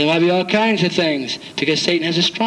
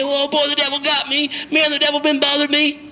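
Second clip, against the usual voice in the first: mock complaining believer; 9 semitones higher than usual. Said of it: "You say".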